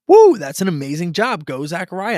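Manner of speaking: proud dad cheering from the sidelines; excited cheer. Shown as a demonstration. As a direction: hype up your little boy's throw. Father cheering son's football throw.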